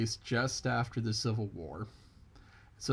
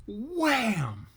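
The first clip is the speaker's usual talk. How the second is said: high, loud "wham!"; smack sound effect; 11 semitones higher, 6 dB louder than usual. Acted. Narrator acts out the rabbit's smack, loud and high. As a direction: punch out the blow as a noise.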